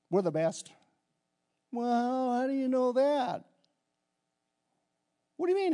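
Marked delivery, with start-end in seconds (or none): 1.7-3.4 a lightly voiced skeptic, higher; doubting voice. Pastor voicing a doubter who questions the team, mock skeptical. 5.4-5.7 much higher, mock disbelief; incredulous. Pastor throwing back the doubter's question, incredulous.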